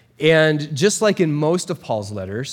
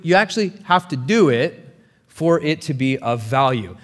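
normal talking voice

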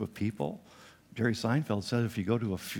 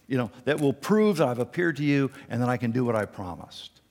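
voicing God; 5 semitones higher, 4 dB louder than usual. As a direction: proclaim it like God himself. Preacher voicing God's promise.

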